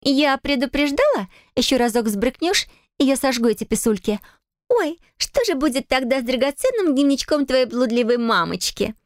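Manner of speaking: high, gloating fake pity; nasty mock sympathy. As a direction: sweetly sneer while threatening. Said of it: "интонации такие мерзкие, сочувственно-глумливые".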